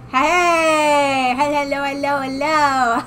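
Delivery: bright, drawn-out hellos; excited greeting. Real, not acted. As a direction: call out happily to chat. Greets chat after tracking returns.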